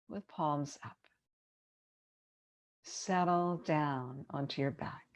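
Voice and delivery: unhurried yoga nidra guidance with long pauses; measured, spacious relaxation-teacher delivery; guided meditation. Acted. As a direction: ease them into rest, phrase by phrase.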